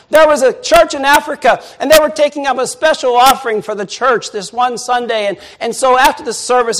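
fervent sermon challenge; preaching. Acted. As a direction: press the congregation hard. Preaching at full force.

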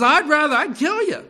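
loud, high acted outburst; angry threat. Balaam answers his talking donkey, loud angry threat.

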